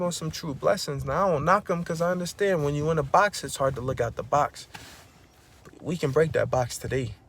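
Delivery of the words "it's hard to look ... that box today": ordinary talk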